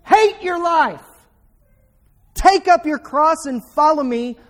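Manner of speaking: high and loud, preaching Jesus' command; preacher booming Jesus' words; forceful command. Acted. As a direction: thunder the call to the cross. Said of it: "And Jesus says".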